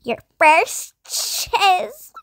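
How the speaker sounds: slow announcer voice. Retold or imitated. Game voice line.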